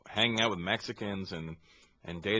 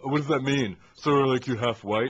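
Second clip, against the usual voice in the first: Indian accent impression; 4 semitones higher, 5 dB louder than usual. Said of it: "they go".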